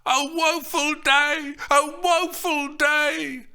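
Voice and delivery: high, loud, slow wailing cry; mock-tragic howl of woe; nurse's shouts. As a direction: drag it out as a keening old nurse. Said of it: "the nurse's shouts".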